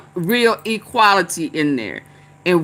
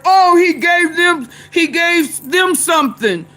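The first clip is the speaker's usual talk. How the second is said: mocking voice; 7 semitones higher than usual. Mocking what people said.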